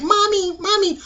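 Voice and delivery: put-on high, eerie little-child "Mommy"; tiny haunted child calling out; creepy baby voice. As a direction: call like a spooky horror-movie baby.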